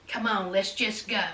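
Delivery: low, hushed urging; quiet coaxing. Retold or imitated. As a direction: coax softly, deep under the voice. Retold coaxing, first take soft.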